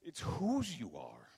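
"whose" drawn out slowly, then falling; slow, weighty emphasis on "whose"; stress on whose. Real, not acted. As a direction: repeat the point with a long "whose". Repeats the key phrase slowly.

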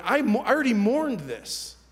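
high, loud anguished protest; worked-up, pained insistence; retold struggle. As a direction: protest it with the pitch pushed up. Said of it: "I'm struggling because I'm going".

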